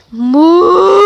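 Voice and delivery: a loud imitated cow moo; loud mock moo. Shown as a demonstration. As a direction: moo like a cow to prompt the dog. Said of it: "is just going".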